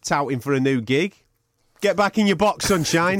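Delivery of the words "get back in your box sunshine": high, teasing put-down; mock scolding. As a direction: playfully tell him off. Teasing co-host's touting.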